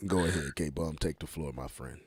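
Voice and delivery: deep rough mock-sick voice; low hoarse voice. Shown as a demonstration. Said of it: "Let me match his tone".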